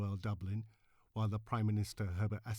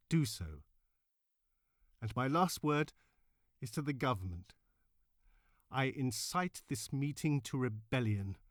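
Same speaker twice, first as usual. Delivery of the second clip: loud, higher, impassioned rally oration; defiant suffragette orator, louder and higher throughout; Pankhurst's angry speech; 4 semitones higher, 4 dB louder than usual. Acted. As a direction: rouse a packed hall to rebellion at full force. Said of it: "Emmeline Pankhurst let forth her anger".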